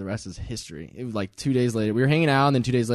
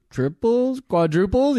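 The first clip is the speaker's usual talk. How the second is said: eager bid; 9 semitones higher, slower than usual. Retold or imitated. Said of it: "We're like".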